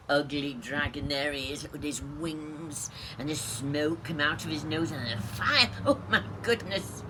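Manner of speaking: ordinary delivery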